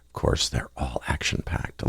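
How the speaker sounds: whispered aside. Real, not acted. Wry aside about his own show.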